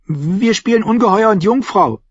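put-on high child's voice; high-pitched little lord; boy explaining. A narrator's reading. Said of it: "erklärte er".

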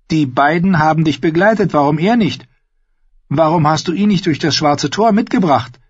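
high-pitched, pressing questions; challenging young woman character. Acted. Narrator voices Mira questioning.

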